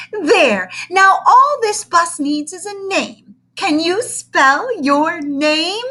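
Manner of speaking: loud, high, slow gushing coo; gushing teacher. Acted. Teacher coos at the big boy, loud and high. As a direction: overdo the sweetness like a doting teacher.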